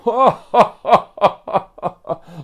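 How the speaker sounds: high, loud belly laughing; uproarious laugh. A narrator's reading. Lumberjack and Sebastian laugh at Joey, uproarious. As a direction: laugh hard at someone's misfortune. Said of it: "were laughing so hard".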